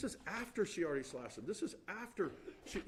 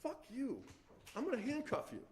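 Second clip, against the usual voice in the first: higher-pitched mock exasperation; frustrated retelling; 7 semitones higher than usual. Lawyer re-enacting the officer for jurors, frustrated. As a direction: imitate an exasperated officer's outburst. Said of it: "He was frustrated because he said".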